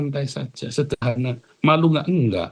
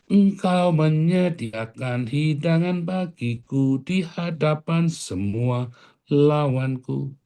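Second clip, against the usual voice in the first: slow scripture recitation; 4 semitones higher, much slower than usual. Acted. Pastor recites Psalm 23 verse.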